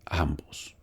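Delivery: a hushed whispered repeat; whispering it to drive the point home. Real, not acted. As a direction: whisper the word for weight. Emphatic whispered repetition.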